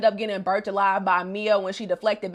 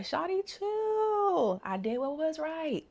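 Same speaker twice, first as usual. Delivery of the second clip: laid-back voice; 7 semitones higher, 9 dB quieter, slower than usual. Retold or imitated. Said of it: "And he's like".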